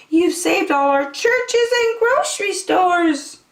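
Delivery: very high, squeaky little voice; tiny high mayor of Whoville; tiny speck voice. Acted. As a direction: voice from a speck of dust. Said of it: "came the voice".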